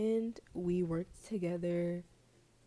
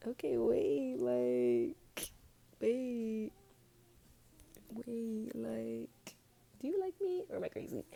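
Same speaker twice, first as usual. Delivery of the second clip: excited disbelief; 4 semitones higher, slower than usual. Retold or imitated. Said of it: "And I was like".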